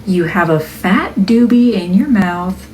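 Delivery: upbeat narration for a product video; video voiceover. Acted. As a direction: read it like a cheerful product reveal. Reads the product voiceover script.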